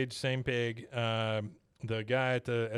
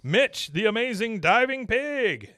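high, loud and slow showman intro; imitating a showman announcer; 9 semitones higher, 5 dB louder, slower than usual. Announcer introduces the star pig, high, loud, slow. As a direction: roll out the name like a ringmaster. Announcer voiced in a retold episode.

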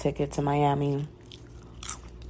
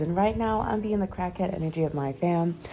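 ordinary talk

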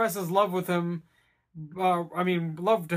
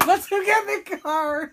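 put-on eager drunk Gary; 11 semitones higher than usual. Mimicking drunk Gary's eagerness.

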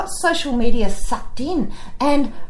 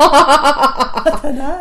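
laughing; 4 semitones higher, 3 dB louder, much slower than usual. Real, not acted. Laughing repeat of own quote.

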